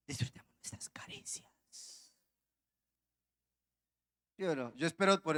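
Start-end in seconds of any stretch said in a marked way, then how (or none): 0.1-2.1 slow, soft seductive whisper; man doing a woman's voice. Retold or imitated. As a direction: breathe it out slowly like a temptress. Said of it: "poner voz de mujeres".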